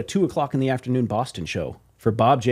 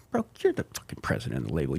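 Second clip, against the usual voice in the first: much lower, softer deadpan disbelief; incredulous aside; 11 semitones lower, 9 dB quieter, faster than usual. Retold or imitated. Answering the label boss, low soft deadpan disbelief. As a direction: say it like it's obvious. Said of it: "I'm like".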